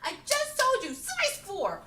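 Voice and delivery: very high, slow mock outrage; high mock indignant shout. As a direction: shriek in mock exasperation. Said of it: "I'm like".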